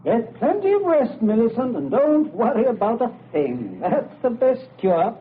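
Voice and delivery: sweet on top, menace beneath; sinister cheer. Acted.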